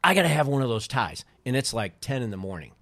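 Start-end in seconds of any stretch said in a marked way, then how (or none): 0.0-1.2 mock freak-out. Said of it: "And he freaked".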